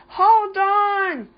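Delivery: high, loud warning shout. A narrator's reading. Gran to the children as the car crashes downhill, loud shout. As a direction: call out for passengers to brace themselves. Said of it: "shouted Gran".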